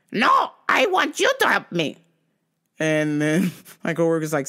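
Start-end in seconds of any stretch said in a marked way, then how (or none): 0.1-2.0 high, mocking demanding-shopper voice; insistent customer. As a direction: caricature a stubborn customer, pitched way up. Impersonates customer refusing again.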